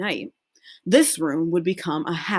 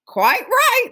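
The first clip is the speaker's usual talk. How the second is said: high chorus of agreement; 4 dB louder than usual. Acted. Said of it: "the others agreed".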